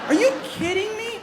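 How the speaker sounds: high disbelieving question; can't believe it; incredulous. Real, not acted. Incredulous outburst.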